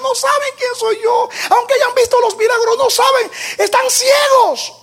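high, loud, pleading cry of Jesus; Jesus crying out from the cross. Acted. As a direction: plead for them at the top of your voice. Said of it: "cuando dijo".

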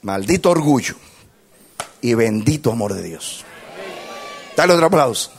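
loud, high-pitched sermon delivery; full-force preaching. Real, not acted. Preacher tells the congregation the conclusion, preaching at full force. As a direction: preach it with force to a big crowd.

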